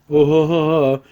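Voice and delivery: a smug mocking chuckle; imitating the guy laughing at her. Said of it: "like, laughs and".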